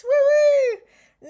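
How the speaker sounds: excited "woo woo" cheer, very high and loud; loud high whoop. Real, not acted. Streamer cheering a viewer's gift, loud excited whoop. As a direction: whoop it out in celebration.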